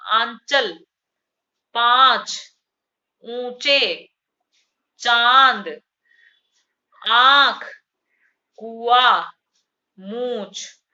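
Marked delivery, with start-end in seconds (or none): 0.1-9.3 each word said loud and separate with the vowel nasalised; आंचल, पांच, चांद, आंख said one by one with a nasal vowel; nasal vowel word list. Shown as a demonstration. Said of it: "नाक से भी हवा आ रही है". (अनुनासिक शब्द ज़ोर से एक-एक करके)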